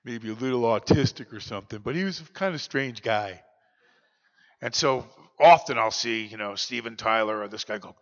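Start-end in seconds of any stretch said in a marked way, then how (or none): 0.0-3.4 a lightly voiced odd older man; Bob Timmons. Shown as a demonstration. Speaker demos how Bob talked, lightly voiced odd man. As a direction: ease into his strange, detached way of talking. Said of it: "He talked just like this".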